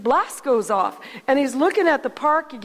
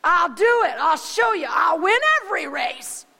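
defiant shout; 6 semitones higher than usual. Retold or imitated. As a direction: yell back that you'll win. Bully accepting the challenge, shouting.

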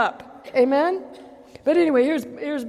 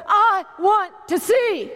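high, slow, earnest cry; I want to see, pleaded high; pleading desire; 7 semitones higher, 3 dB louder, slower than usual. Retold or imitated. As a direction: yearn to see. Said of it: "He said".

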